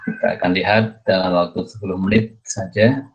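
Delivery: normal talking voice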